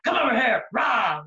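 loud, mock-menacing call and roar; pouncing leech. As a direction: lunge at the victim like a silly monster. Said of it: "Like a leech".

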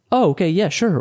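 bright, quick agreement from the client; mock happy client; easy yes. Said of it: "the client will go".

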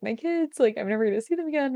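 telling a friend about losing her toddlers, mock sob; mock sobbing.

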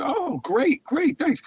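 high-pitched eager thanks; delighted thanks. Retold or imitated. Thanking the famous clown for his offer, high and eager. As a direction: pitch it up high, grateful and thrilled. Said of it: "And I said".

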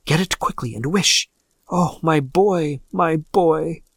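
urgent, then drawn-out grieving cry; plead, slowing into a mother's lament; anguished calling for her son; panting plea. A narrator's reading. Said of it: "she panted".

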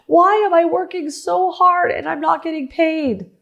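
high, wailing cry of frustration, slowed on the first words; retold frustrated cry. Owner struggling to get paid cries out in frustration. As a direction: cry out in overworked despair. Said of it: "you start to go".

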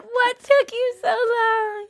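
high, slow, teary hammed-up shrieks; mock ecstatic fiancée. Imagining Brad Pitt proposing to her, mock teary shrieks. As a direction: overplay the overjoyed sobbing bride. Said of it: "I'd be like".

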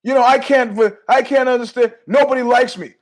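high, loud, self-pitying wail; hammed-up woe-is-me outburst; mock a desperate attention seeker; whining complainer.

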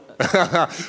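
loud burst of real laughter; hearty laugh. Real, not acted. Fighter cracks up after teasing the reporter, real laugh. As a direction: laugh out loud at your own joke.

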